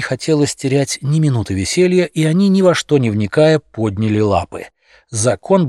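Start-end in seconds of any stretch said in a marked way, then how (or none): none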